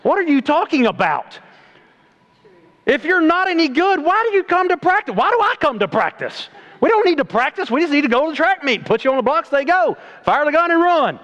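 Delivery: high, fired-up pulpit voice; fiery preaching. Real, not acted. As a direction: preach it hard to the crowd. Preaching at full force.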